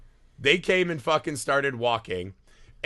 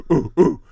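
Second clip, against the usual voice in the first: loud mock caveman hooting; imitated caveman's son going "ooh"; caveman grunts; 5 dB louder than usual. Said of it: "his son was just like".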